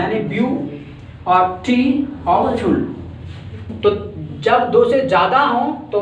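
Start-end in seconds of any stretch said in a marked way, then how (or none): 0.3-2.7 view, टी, फुल each set apart; syllables listed separately. Shown as a demonstration. Teacher counting three syllables, view, टी, फुल listed separately. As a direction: count out ब्यू और टी और फुल, pausing between parts. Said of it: "तीन syllable है".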